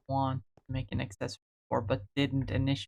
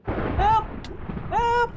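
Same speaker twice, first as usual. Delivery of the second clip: mock cry for help; 3 dB louder than usual.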